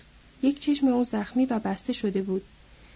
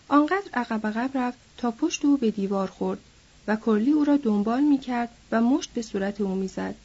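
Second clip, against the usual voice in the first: ordinary delivery, nothing marked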